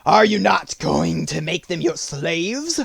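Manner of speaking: wolf. Acted. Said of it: "asked the leader of the wolves".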